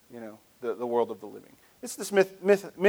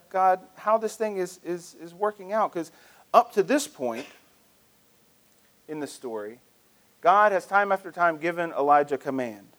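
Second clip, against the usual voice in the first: usual speaking voice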